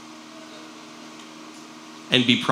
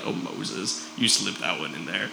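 knowing tease; 7 semitones lower, 10 dB quieter than usual. Retold or imitated. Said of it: "we're like".

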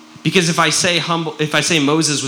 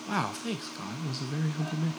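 quiet mock Moses; 13 dB quieter, faster than usual. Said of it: "He's like".

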